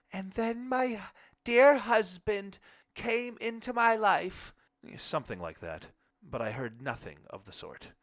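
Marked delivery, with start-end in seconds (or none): 0.1-4.5 much higher, louder, slow and sweet; imagined wife praising her husband; wife's voice. A narrator's reading. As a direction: gush it as the adoring wife.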